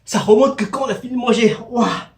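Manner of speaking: high, loud pained gasps from spice; mouth on fire. Real, not acted. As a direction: talk through burning chili heat. Mouth burning after spicy dish. (la bouche en feu, voix aiguë)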